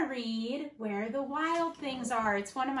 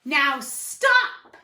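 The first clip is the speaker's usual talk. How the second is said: louder stern command; firm order; 3 semitones lower, 8 dB louder than usual. Acted. Max to the wild things, loud firm order. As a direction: put your foot down. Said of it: "Max said".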